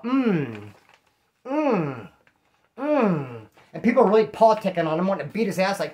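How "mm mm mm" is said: long falling "mmm" moans, mimicking a noisy eater; mock moan of relish over food; imitated eating noise. Imitating a cellmate who moans every time he eats, drawn-out mock moaning. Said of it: "he'd be all".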